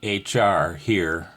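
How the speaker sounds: slow, spaced-out call example; deliberate demo pace; slow example phrase. Shown as a demonstration. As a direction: spell out the on-air call slowly, word by word. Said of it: "If you said".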